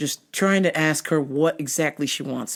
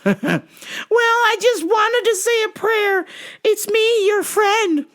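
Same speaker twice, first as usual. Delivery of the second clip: put-on preachy old lady; 4 dB louder than usual.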